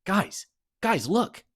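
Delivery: high excited call; excited girl calling. Acted. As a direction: call out, thrilled. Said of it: "Riley perked up".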